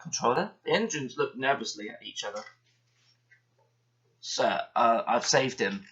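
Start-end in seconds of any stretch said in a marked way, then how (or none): none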